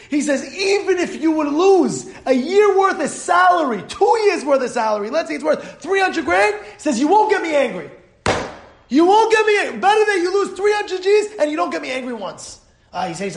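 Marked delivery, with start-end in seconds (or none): 0.5-6.5 impassioned mock protest; emphatic retort. Rabbi's emphatic retort to the bettor. 7.0-12.6 high-pitched insistent retort; impassioned, raised-voice insistence; emphatic refusal. Acted. Rabbi's emphatic refusal to be angered.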